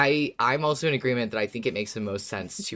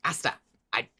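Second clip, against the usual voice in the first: lightly voiced clueless Yorick, a bit higher and fast; imitating Yorick; 5 semitones higher, much faster than usual. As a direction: play Yorick shrugging it off, quick and unsure.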